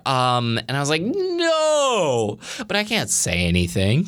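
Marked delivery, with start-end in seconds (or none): 1.0-2.4 long, high wail of "no"; retold silent protest, drawn out high; dismayed inner no. Speaker reliving her silent reaction, high dismayed cry.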